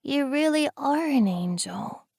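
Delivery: put-on high, faint child's voice; a frail child murmuring against his chest; weak little girl. A narrator's reading. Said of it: "Laurel says weakly".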